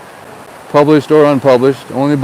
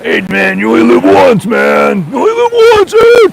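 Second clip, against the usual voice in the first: hammed-up neighbor yelling his motto; John's way of talking; 9 semitones higher than usual. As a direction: bellow the motto like the pushy neighbor. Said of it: "do you believe in the sentiment".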